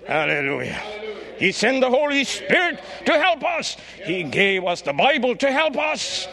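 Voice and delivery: rising to high, loud shouted refrain; building litany. Acted. Preacher building the refrain, rising to a shout.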